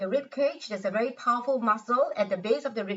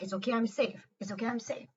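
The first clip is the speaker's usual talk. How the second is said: softer, calming self-reassurance; 6 dB quieter, faster than usual. Shown as a demonstration. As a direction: soothe yourself gently, almost under the breath. Soothing self-talk while tapping.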